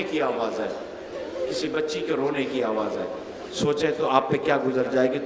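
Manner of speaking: soft, quick hushed telling of the lament; hushed recitation. Acted. Preacher to mourners, a hushed quick lament. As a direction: draw the mourners in quietly.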